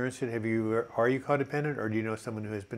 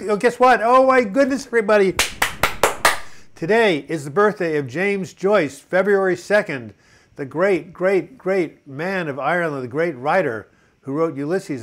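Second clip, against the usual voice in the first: excited; 6 semitones higher, 10 dB louder than usual. Real, not acted. Bursts out with birthday news.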